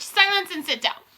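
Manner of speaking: high, loud order; commanding shout. A narrator's reading. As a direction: order the room to settle. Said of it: "shouted the Grand High Witch".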